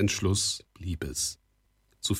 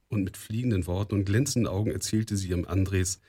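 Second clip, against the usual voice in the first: ordinary delivery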